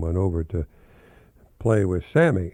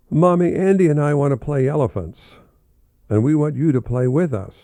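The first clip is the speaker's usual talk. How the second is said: put-on louder, higher, eager child's plea; pleading child; 5 semitones higher, 6 dB louder than usual. Marvin asks his mother to play elephants, loud and eager. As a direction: beg mommy to play along.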